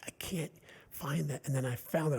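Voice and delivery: normal talking voice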